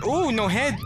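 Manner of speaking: ordinary talk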